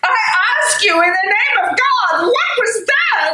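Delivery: frightened wife begging to know about the shots; desperate demand. Acted. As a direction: press him hard in fear.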